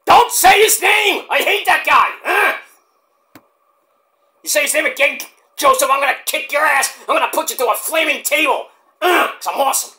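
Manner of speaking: hammed-up furious wrestler shout; Mike Awesome rant. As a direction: scream threats like an enraged wrestler. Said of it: "my Mike Awesome impression".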